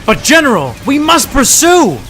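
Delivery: loud, high urgent protest; insistent shout. Acted. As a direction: push back hard on the general. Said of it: "Graves insisted".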